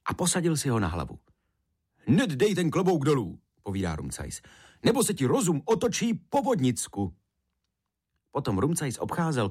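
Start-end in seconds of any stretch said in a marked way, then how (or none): 2.1-7.2 raised, brisk warning; urgent order. A narrator's reading. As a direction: order it sharply as a storyteller's character. Rumcajs orders boy, warns him.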